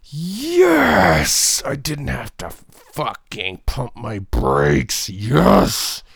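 loud, high cheer, slow middle; mock freak-out. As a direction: celebrate a green light like a touchdown. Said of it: "are they freaking out? Just like".

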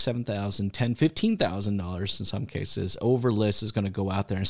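normal talking voice

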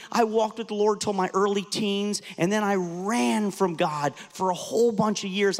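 ordinary delivery

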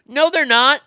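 high, loud flat denial; emphatic retort. Real, not acted. Host scoffing at fake apologies, loud emphatic denial. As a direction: shoot it down hard.

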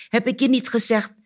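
high, insistent reproach; exasperated prompting. A narrator's reading. Anansi prompts the forgetful cricket, exasperated.

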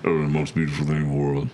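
deep, low Vin Diesel imitation. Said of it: "he's got a real deep voice".

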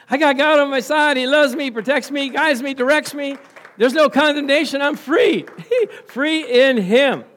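loud, high, joyful outburst with laughter; real rising excitement, shouted praise; excited. Real, not acted. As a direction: let the joy burst out, laughing. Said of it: "I'm getting excited".

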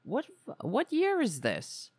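high-pitched, incredulous retold question; shocked disbelief. Recalling the reaction to husband's remark, shocked. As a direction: act out being taken aback, pitch jumping up. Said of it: "I was shocked. I was just like".

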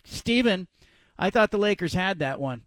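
usual speaking voice

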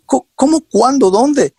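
high, stumbling retold astonishment; incredulous questioning. Retold disbelief, could not believe it. (preguntas incrédulas, con voz aguda)

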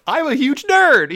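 high, loud, drawn-out retold confession; mock gushing outburst. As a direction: blurt it out loudly, hamming up the nerdy excitement. Retelling own gushing confession to her.

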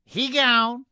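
high, loud, put-on voice; mock slang quip. Host mocking the absent co-host, high silly voice. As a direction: toss it off in a silly character voice.

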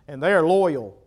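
ordinary talk

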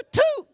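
high squeaky toot; a jump way up for a tiny horn; imitated horn toot.

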